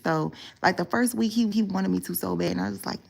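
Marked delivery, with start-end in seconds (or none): none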